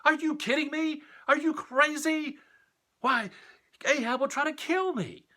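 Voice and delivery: a terrified servant protesting in a high, frantic voice; panic mode. Acted. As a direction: squeal in frantic disbelief. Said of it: "Obadiah went into panic mode".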